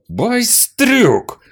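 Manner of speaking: high, loud enraged bellow; furious shout. Acted. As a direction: spit the insult out in fury. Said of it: "вызверился мужчина".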